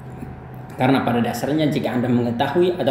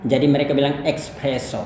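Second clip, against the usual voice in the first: ordinary talk, nothing marked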